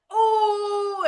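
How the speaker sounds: a high, sudden room-wide "Oh"; shocked gasp. Retold or imitated. Retelling how the room reacted, shocked gasp. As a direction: gasp like a stunned audience.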